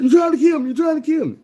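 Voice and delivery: high, loud, panicked yell; the half-drowned man screams at his mentor, panicked; panicked shout. Acted. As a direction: yell it like you just nearly drowned.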